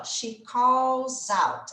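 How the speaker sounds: "She calls out" said very slowly, each word apart; slow, separated classroom model. Shown as a demonstration. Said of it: "Aqui a gente já vai juntar na hora de falar".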